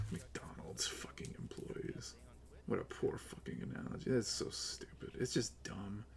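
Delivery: very quiet, under the breath; real, hushed contempt; disgusted muttering. Real, not acted. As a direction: mutter it to yourself in disbelief. Disgusted at the analogy, to himself.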